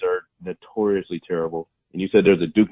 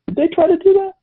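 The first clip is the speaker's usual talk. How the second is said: sudden high, louder surprised question; 5 dB louder than usual. Real, not acted. Friend surprised by a Ninja Gaiden movie idea, high surprised question. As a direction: ask in genuine surprise.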